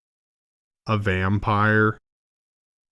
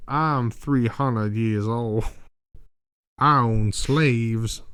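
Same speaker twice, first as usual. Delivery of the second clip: slow, grave Southern boast; lightly drawled old plantation gentleman; vampire boasts of his age, mock Southern; mock Southern lawyer; much slower than usual. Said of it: "The only people that talk like that owned slaves".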